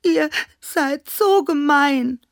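loud, high wail stretched on "so"; sobbing. A narrator's reading. Klaus Teddy protests to the mean toys, sobbing. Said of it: "schluchzte Klaus Teddy".

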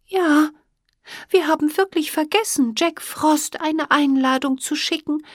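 high, apologetic little fairy; young fairy voice. Acted. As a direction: admit it sheepishly, high and small. Emma voiced admitting fault.

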